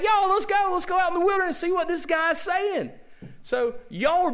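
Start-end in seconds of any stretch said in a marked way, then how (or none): 0.0-2.9 much higher, excited mock crowd voice; hyped-up townsfolk urging each other on; eager crowd. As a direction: play the fans rushing out to see him. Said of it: "You were like".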